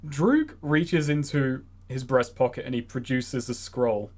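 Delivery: normal talking voice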